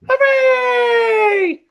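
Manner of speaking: very high joyful shout; cheer. Acted. Bees cheering Norman the hero. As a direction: cheer it with delight.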